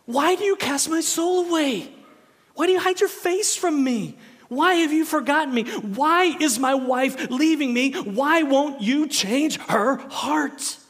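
loud, high, anguished shouting; full-force desperate prayer, stretched at the end; screamed psalm. Shown as a demonstration. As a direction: yell your questions at God. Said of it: "that's how you have to read".

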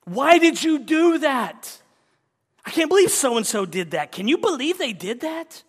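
high, loud incredulous scolding; mock exasperation. Preacher imitating people scolding sinners. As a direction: rant at someone who let you down. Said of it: "We say things like".

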